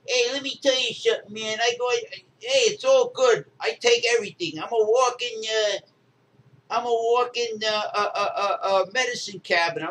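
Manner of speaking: high-pitched boastful weightlifter voice; friend's Tyson-like voice. Retold or imitated. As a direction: imitate a high-voiced strongman bragging, stumbling over words. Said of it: "he sounds just like Mike Tyson".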